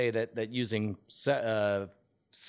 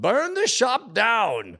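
mock shout; 9 semitones higher, 4 dB louder, much slower than usual. Reading game's shouted line.

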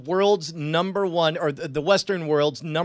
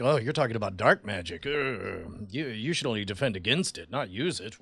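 mock wise mentor; 9 semitones lower, 6 dB quieter than usual.

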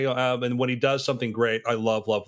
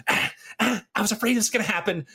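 high-pitched, hurried mimicry of an actor; retold actor's nervous aside, pitched up and quick; Jamie Foxx; 8 semitones higher than usual. Retelling an actor pulled aside by the director, impression. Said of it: "he does this great impression".

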